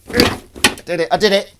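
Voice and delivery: high, loud little victory cry; triumphant. Real, not acted. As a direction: celebrate a small win loudly. Celebrates finally shutting something.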